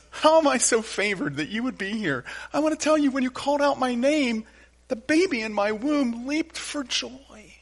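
high-pitched voice of an amazed woman; acted female voice, marvelling; Elizabeth. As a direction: exclaim it high, slowing toward the end. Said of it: "Elizabeth makes the strangest remark. She says".

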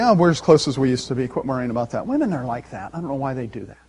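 mock-dismissive, trailing down; brushing it off. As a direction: wave the complaint away under your breath. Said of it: "just kind of brush it off".

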